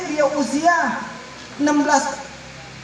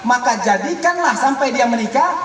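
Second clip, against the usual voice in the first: usual speaking voice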